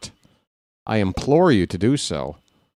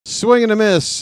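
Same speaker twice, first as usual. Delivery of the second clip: excited strikeout call; 9 semitones higher, 4 dB louder than usual. Real, not acted. Announcer calling a strikeout, excited call. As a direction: pitch jumps high on the swing and miss.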